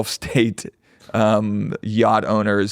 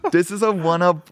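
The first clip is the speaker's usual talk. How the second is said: put-on higher-pitched French-accented gallerist; 7 semitones higher than usual. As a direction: mimic the French accent, about to laugh. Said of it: "and the French accent".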